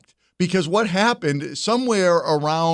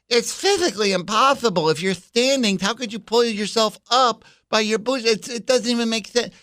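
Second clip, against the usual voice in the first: higher, mock-whiny voice; mocking imitation; 7 semitones higher than usual. Mocking AOC on bootstraps, high whiny mock voice. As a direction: parody a clueless politician. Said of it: "A O C".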